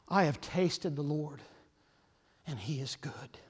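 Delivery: softly, almost whispering the testimony; hushed testimony. Acted. Preacher voicing a believer, hushed and intimate. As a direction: let it sink to a hush. Testifying quietly of God's goodness.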